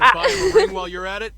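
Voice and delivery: loud, high laughing jab; laughing tease. Acted. Teasing, laughing.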